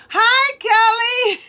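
very high, loud, slow singsong hello; excited greeting. Real, not acted. Streamer spots Kelly joining the live, excited greeting. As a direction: break off and sing out a delighted greeting.

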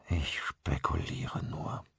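slow, despairing whisper; desperate. A narrator's reading. Father admits to the installer he is guessing, despairing whisper. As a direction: breathe it out slowly, almost without voice. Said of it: "sagte er verzweifelt".

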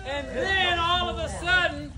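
ordinary delivery, nothing marked

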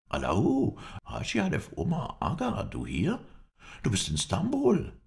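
high-pitched, amazed cry of recognition; surprised, rising questions; astonished exclamation. A narrator's reading. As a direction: call out in disbelief at meeting a friend. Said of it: "rief er erstaunt".

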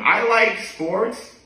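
stretched, slow delivery; slow deadpan. Pretend. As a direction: drag each word out. Slow punchline echo.

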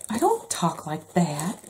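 slow, protesting mock mom. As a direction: drag out a mom's indignant denial. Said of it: "when your mom hears it, they're like".

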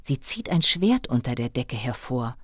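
plain reading voice

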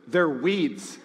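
blunt answer breaking into a laugh; laughing blunt reply. Retold or imitated. Ted answering the wife's question with a laughing blunt reply. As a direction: chuckle through it, deadpan underneath. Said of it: "And Ted goes".

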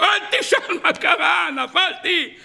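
high, slow, exaggerated wail; screaming in distress. Shown as a demonstration. Child pre-empts punishment by reporting his fall, hammed-up screaming. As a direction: cry out as if disaster struck. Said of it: "אם אתה מתחיל לצעוק".

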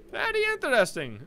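put-on high little-boy voice; child's echo. Fluffy echoing David's interest, high child voice. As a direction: copy the grown-up in a kid's voice.

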